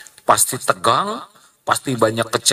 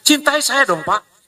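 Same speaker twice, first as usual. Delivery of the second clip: mock pleading; 12 semitones higher than usual. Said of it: "Coba gimana caranya supaya dicintai?".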